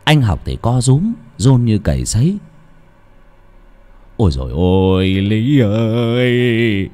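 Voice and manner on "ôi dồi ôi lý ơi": slow, wailing plea with each "Lý ơi" drawn out; terrified begging. A narrator's reading. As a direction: beg the ghost in terror, stretching her name. Terrified old man begs ghost. (ông Toán van xin hồn ma, giọng run sợ kéo dài)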